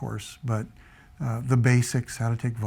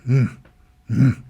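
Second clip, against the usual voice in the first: louder stern hum of disapproval; disapproving grunts; 7 dB louder than usual. Retold or imitated. Elderly Japanese man unmoved by the apology, stern loud grunts. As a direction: grunt twice like an unimpressed elder. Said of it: "he's just going".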